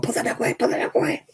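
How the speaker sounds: high, panicky mock plea; panicked postwoman. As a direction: imitate a frightened postwoman pleading. Retold postwoman's panicked plea at the door.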